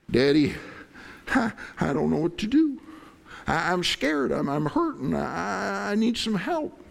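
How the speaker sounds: put-on high, small, frightened child's voice; scared child. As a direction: plead like a scared little kid. Child voice asking daddy for help.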